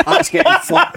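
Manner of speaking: high laugh into the words; cracking up mid-line. Real, not acted. Friend cracking up picturing the keeper's long kick.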